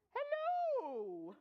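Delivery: high, loud, drawn-out hello; a silly surprise voice from the puppy; comic hello. Acted. Puppy speaks like a human.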